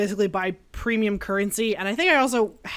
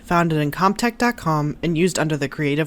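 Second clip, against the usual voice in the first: credits read; 7 semitones lower, 4 dB louder than usual. Acted. Reads music credit.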